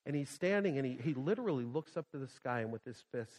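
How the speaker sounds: usual speaking voice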